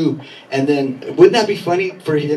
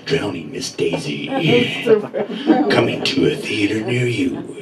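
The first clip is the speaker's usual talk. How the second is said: low and ominous like a horror trailer narrator; movie trailer announcer; 6 semitones lower than usual. Retold or imitated. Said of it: "He'd be like".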